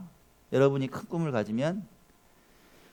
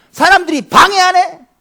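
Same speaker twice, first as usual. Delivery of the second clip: indignant surprise; 14 dB louder than usual. Acted. Preacher builds to full force.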